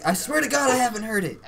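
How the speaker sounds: put-on high voice protesting innocence; high-pitched protest. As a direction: swear it in a high, pleading pitch. Acted protest to disbelieving viewers.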